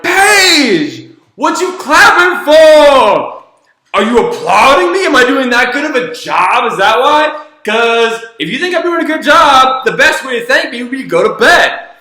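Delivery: high, loud mock-outraged retort; giving it back. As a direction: throw her tantrum back at her, exaggerated and shouted. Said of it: "give it back to her".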